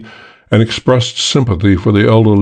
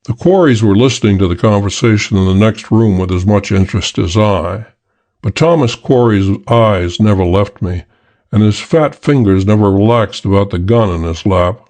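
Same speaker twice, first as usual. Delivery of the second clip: ordinary delivery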